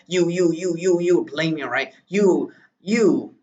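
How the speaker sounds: high, loud accusing you, you; angry accusation. Acted. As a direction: jab the words at the boss in anger. Boy's angry outburst at boss.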